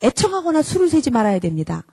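normal talking voice

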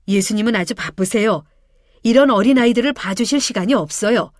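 scolding loud outbursts, sharp and impatient; the disciples angrily turn the mothers and children away; angry disciples. A narrator's reading. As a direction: shoo the mothers away crossly. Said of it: "막 화를 내는 것이었어".

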